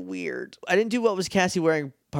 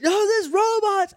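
much higher, louder alarm; frantic Justin; 3 dB louder than usual. Retold or imitated. Justin warning the others, very high mock panic. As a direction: squeal the warning. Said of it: "And he's like".

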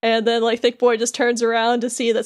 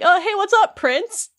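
casual greeting; 9 semitones higher than usual. Retold or imitated. Sora strolls up to Beast all relaxed, high casual greeting. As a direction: toss out an easy, upbeat greeting. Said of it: "casually runs up to Beast".

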